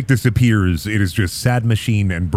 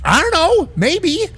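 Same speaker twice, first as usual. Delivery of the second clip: much higher, shrugging arbiter voice. Acted. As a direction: play the odd arbiter, dodging the question.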